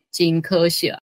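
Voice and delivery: ordinary talk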